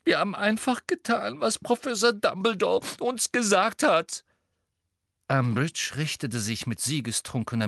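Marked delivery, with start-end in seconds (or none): 0.0-4.2 high, whiny, tearful excuse; sniffling. A narrator's reading. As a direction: plead innocence in a squeaky whimper. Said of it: "sagte Hermine laut schniefend".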